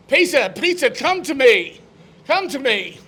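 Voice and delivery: high, pleading call to a follower. Acted. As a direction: call him over from afar. Jesus calls from the cross.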